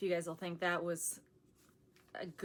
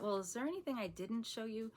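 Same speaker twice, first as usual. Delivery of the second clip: ordinary talk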